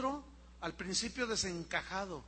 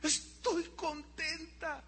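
tearful and happy; 8 semitones higher, much slower than usual. Retold or imitated. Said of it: "Y sus ojos otra vez llenos de lágrimas".

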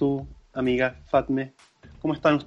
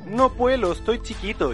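whiny complaining; 7 semitones higher than usual. Pretend. Whiny quoted self in intro.

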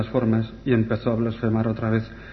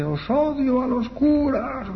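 high, long-drawn wail without real volume; imitated possessed scream; 11 semitones higher, 4 dB quieter, much slower than usual. Possessed girl turns on the priests, in an imitated high scream. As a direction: mock-scream it slowly, way up high. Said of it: "gritando con esa voz".

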